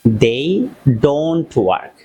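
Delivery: each word drawn out slowly for learners. Shown as a demonstration. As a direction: spell it out slowly like a teacher. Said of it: "আমি খুব আস্তে আস্তে বলছি". (খুব ধীরে)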